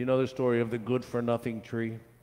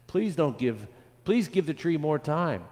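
mild, higher-pitched plea; begging; 6 semitones higher than usual. A narrator's reading. Children ask gardener for time, mild begging. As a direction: beg lightly, as a child. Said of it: "the children begged".

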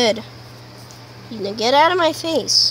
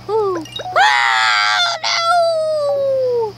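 high squealed oh no; dramatic outcry; 11 semitones higher, much slower than usual. Real, not acted. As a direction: wail it out in dismay. Game loss outburst.

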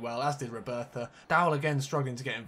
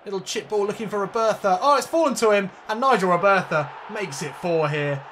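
rising higher and louder into a goal shout; excited commentary; 6 semitones higher, 8 dB louder than usual. Real, not acted. Calling a chance for viewers, building excited shout. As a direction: build as the ball falls to him.

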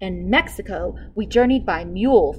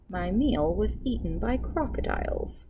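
usual speaking voice

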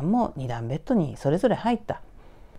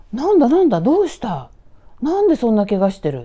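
loud, raised, urgent questions; alarmed questioning; 5 semitones higher, 7 dB louder than usual. Retold or imitated. Storyteller as roommates woken by an injured friend, loud alarm. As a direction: call out in shock at the injury.

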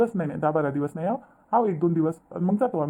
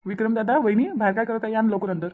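calling out; 4 semitones higher than usual. Acted. Brother-in-law calls the couple waiting outside to come in, calling out. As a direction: call to people outside, slightly raised pitch. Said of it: "मी आवाज देतो दादाला".

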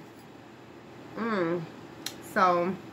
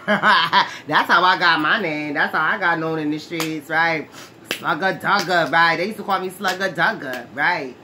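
getting excited; 8 dB louder than usual. Real, not acted. Telling viewers her street name, excited and loud. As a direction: let the excitement run away with you. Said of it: "I'm getting all excited".

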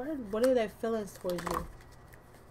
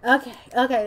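excited okay; 3 semitones higher, 9 dB louder than usual. Real, not acted. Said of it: "Let me stop".

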